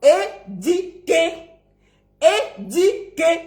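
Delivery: slow "é-di-quer" for "éduquer"; syllable-by-syllable "i" version of "éduquer"; wrong pronunciation. Shown as a demonstration. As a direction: show the error, "di" in "éduquer". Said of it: "Et non éduquer".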